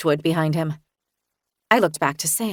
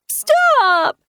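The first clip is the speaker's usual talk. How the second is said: high, loud tearful cry; a child near tears yelling stop; tearful child shout; 6 dB louder than usual. Acted. Sam crying out at Magni, tearful high shout. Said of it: "Sam said tearfully".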